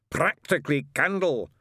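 slow, high, emphatic shout. Acted. Witchfinder brandishes his lighter, shouting. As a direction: cry it out like an exorcist. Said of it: "he shouted".

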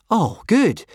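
high, loud burst of relief; hugely relieved. A narrator's reading. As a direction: exclaim with huge relief. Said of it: "hugely relieved".

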